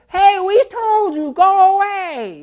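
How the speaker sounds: high, loud, slow shout; neighbor yelling. Retold or imitated. Neighbors yell at the returning attacker, loud and slow. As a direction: bellow out a warning from a window.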